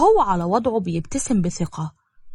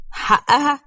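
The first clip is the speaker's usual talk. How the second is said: higher, sharply rising question; incredulous; 6 semitones higher, 3 dB louder, much slower than usual. A narrator's reading. Brother echoing his sister's defence of a woman, incredulous. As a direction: throw the word back in disbelief.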